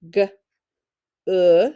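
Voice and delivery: "G" and "uh" as separate sounds with a pause; sounded out. Shown as a demonstration. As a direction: give "G" as a short sound, then "uh" on its own. Teacher sounds out the word good.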